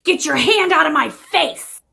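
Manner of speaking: a first firm, acted warning; annoyed warning. Woman warning someone blocking her face, annoyed.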